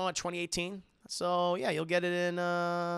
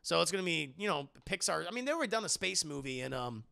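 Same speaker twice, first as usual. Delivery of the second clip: ordinary talk, nothing marked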